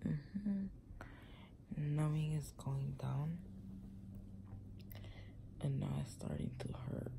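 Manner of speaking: hurting, whispering through a sore mouth; barely voiced after wisdom teeth; pained whisper. Real, not acted. Whispering in pain after surgery.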